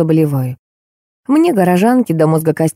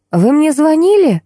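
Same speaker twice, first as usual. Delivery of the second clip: higher, curt demand; mildly barked question; 10 semitones higher than usual. A narrator's reading. Unknown woman calls back the heroine, mildly barking. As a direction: demand it sharply, pitched well up. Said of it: "рявкнула женщина".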